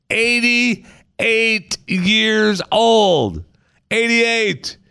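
high, drawn-out disbelief; amazed. Real, not acted. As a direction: stretch it out in awe. Stunned at Shatner's age.

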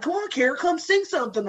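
playful high-voiced coaxing. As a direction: imitate friends egging someone on. Friends coax her to sing.

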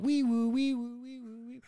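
high wee woo siren noise; siren imitation. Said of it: "run to the squad bay going".

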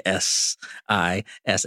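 each letter drawn out slowly; slow spelled letters. Retold or imitated.

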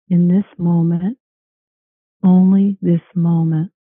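slow, calm meditation voice; guided meditation. Acted. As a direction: guide them into calm, slowly. Leads a guided relaxation.

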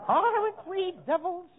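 high, slow giggling gloat; mock devilish glee. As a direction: savor the mischief with a laugh. Gleeful villain after bomb joke.

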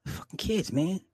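breathy exasperated whisper under the breath; whispered aside. Real, not acted. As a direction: hiss it to yourself, fed up.

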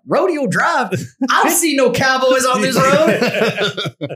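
high, loud, drawn-out movie line; hammed-up high-pitched impression; movie character quote. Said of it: "and he's like".

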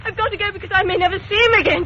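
fast and urgent, pushing hard; desperate outburst. Acted. Myra insisting to Kitty she must go, frantic rush. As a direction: spill it out in a rush.

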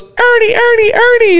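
put-on high-pitched eager child's call; eight-year-old kid calling out. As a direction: mimic a little boy calling to his hero. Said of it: "there's about an eight-year-old kid".

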